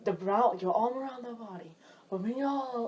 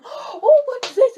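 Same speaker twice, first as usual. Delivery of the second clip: high, loud, slow gushing oh with a laugh; nosy guest; 14 dB louder, slower than usual. Retold or imitated. New guest picking up things in bedroom, excited gushing. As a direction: gush over everything like a nosy visitor.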